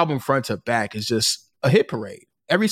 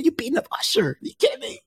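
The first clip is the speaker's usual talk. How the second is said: high, softer incredulous pitch; disbelieving squeak, pitched way up; 13 semitones higher, 5 dB quieter, faster than usual. Acted. Disbelief at rumored fight.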